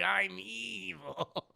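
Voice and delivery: very slow, drawn-out gloat sliding into laughter; hammed-up evil glee; gleeful mock villain. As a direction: stretch it out and cackle after. Mocking gleeful David, amid laughing.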